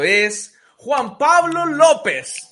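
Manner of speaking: loud, high, drawn-out name reveal; big stretched-out game-show announcement; announcer reveal. Pretend. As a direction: proclaim the winner's name like a host. Host reveals the answer.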